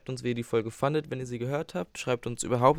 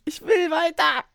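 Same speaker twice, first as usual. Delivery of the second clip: high, slow whining; whiny child voice; 9 dB louder, slower than usual. Pretend. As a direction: whine like a stuck kid. Whining at the app.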